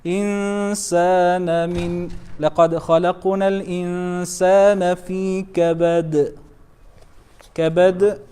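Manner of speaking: flowing chant, كبد ending on a bounced d; major qalqala. Shown as a demonstration. Teacher finishing the verse, chanted major echo on كبد. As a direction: recite on, land كبد with a full echo. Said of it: "again. كبرى".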